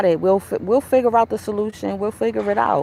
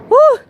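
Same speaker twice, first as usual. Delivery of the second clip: a high, loud laughing whoop; loud joyful whoop; 9 dB louder than usual. Real, not acted. Vlogger whoops at her own joke, loud and high. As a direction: let out a loud happy holler.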